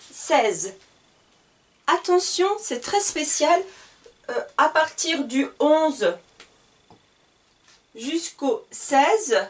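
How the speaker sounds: normal talking voice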